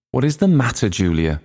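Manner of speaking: lightly worried questioning; anxious. A narrator's reading. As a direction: ask high at first, with a touch of worry. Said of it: "asked Aunt Kate anxiously".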